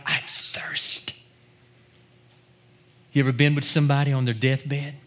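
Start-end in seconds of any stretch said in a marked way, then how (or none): 0.0-1.1 breathless, voiceless "I thirst" barely said; dying whisper. Acted. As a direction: whisper "I thirst" like a man with no strength left. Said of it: "he cried out".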